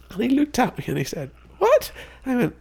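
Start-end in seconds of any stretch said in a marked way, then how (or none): 1.6-1.9 much higher, louder, stunned "What?"; shocked "What?". Retold or imitated. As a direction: blurt it out in disbelief. Master shocked by the demand.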